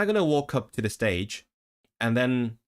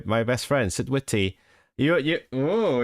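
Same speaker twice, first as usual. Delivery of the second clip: ordinary delivery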